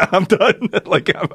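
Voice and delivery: high, giggly giving up; laughing surrender. Retold or imitated. Traveler hearing about parasites, laughing surrender. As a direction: crack up while bowing out.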